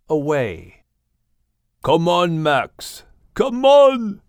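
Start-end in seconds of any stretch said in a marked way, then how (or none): 1.8-4.2 calling loudly to Max. A narrator's reading. Animals calling Max to surface, calling out. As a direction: shout it across the water. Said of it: "the animals called".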